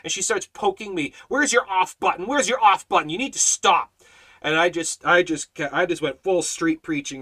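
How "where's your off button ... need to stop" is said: higher-pitched imitation of an irritated heckler; irritated demand. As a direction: imitate her pestering him to stop. Woman poking and berating him, retold.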